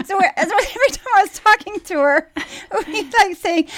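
high, fast laughing talk; words bubbling through laughter. Real, not acted. Laughing while telling funny story.

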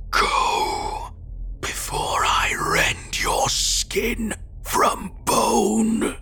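slow, drawn-out rasping snarl; snarling threat. A narrator's reading. Gray man threatens to flay the driver, slow snarl. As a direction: snarl each word out in a harsh whisper. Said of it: "he snarled, low in his voice".

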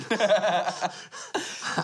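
laughing. Real, not acted. Laughing at own gross question.

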